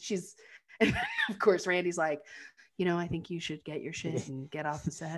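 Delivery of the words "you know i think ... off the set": "You know, I think you should get your shit and get off the set" said much softer, sheepishly; a henpecked husband mumbling her line; meek repeat. Retold or imitated. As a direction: parrot his wife meekly and quietly. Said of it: "he just repeats, you know, what Janet had said".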